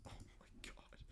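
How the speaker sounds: breathless whisper; stunned whisper. Real, not acted. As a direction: whisper it in shock. Stunned after story.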